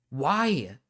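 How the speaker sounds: ordinary talk, nothing marked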